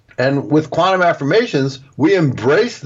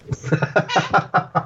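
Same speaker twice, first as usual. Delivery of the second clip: breathy laugh; 6 semitones higher, 5 dB quieter than usual. Real, not acted. Laughs at the joke.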